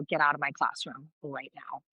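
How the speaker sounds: low, restrained teacher's order; stern low command, no shouting; retold telling-off. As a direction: retell it as a low, stern dismissal. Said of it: "so I laid into him".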